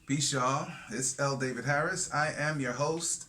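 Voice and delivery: host intro. Acted.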